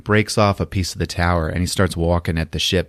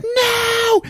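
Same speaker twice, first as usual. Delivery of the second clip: very high, drawn-out wail; mock scream. Woman about to be picked up, high mock scream. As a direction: cry out like a hammed-up damsel. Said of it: "she's like".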